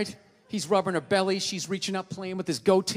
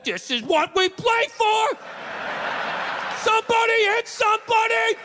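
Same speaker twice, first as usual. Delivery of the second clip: building slow then high shouted hype; pumped-up war cry; 13 semitones higher, 4 dB louder than usual. Retold or imitated. Dog psyched for the Rose Bowl, shouting. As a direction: fire up for the big game. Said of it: "walking through the tunnel at the Rose Bowl".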